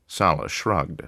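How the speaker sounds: usual speaking voice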